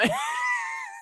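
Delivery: squealing burst of laughter; a sudden high-pitched shriek of laughter. Real, not acted.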